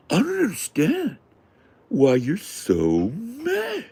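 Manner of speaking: very high, slow, faux-confused whine; hammed-up fake bewilderment; mock innocent gaslighting. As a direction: play dumb with exaggerated innocence. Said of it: "So it's just gaslighting".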